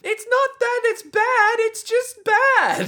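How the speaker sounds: very high, loud put-on squeaky voice; tiny helper complaint. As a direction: squeal it, dragging out the end. Joins in as little Sex Pistols.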